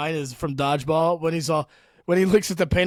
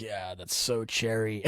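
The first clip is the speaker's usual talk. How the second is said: low, soft, slow admiring murmur; lightly voiced dreamy car admirer, lower and slower; Paul Rudd impression; 6 semitones lower, 8 dB quieter, slower than usual. Admiring a yellow car in a movie, low slow murmur. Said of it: "Paul Rudd's like".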